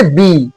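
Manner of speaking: low, held বি for the learners; pronunciation demo. Shown as a demonstration. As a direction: say বি alone as a model sound. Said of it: "কি উচ্চারণ হচ্ছে".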